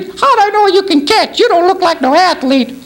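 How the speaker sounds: hammed-up panicked lady screaming from a window; a trapped mother calling down from the burning building, frantic falsetto; frantic woman. Lady's voice again.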